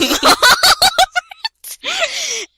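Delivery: loud, high laughter; can't stop laughing; shrieking laugh. Real, not acted. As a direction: squeal with laughter. Laughing at silly outfit.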